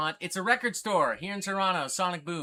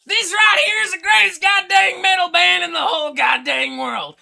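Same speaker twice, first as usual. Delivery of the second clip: screaming metalhead, far higher and louder, slow on the big words; Thrashin' Jackson impersonation; 10 semitones higher, 14 dB louder, slower than usual. Retold or imitated. Streamer impersonates metal fan Thrashin' Jackson for chat, screaming and hammed-up. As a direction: rant like a hammed-up thrash fanatic. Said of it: "he would probably say something along these lines".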